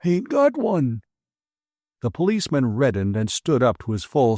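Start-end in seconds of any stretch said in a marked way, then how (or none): 0.0-1.0 put-on high, curt old man voice; a contrary old man refusing; stubborn old man. A narrator's reading.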